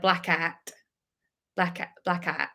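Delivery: a light imitation of Ben's Australian accent, saying Blackout softly; mock Australian accent. Copying a friend's Australian Blackout, a light Australian accent. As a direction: Blackout with a rough Australian vowel, like Ben says it. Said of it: "Trying to do an Australian accent".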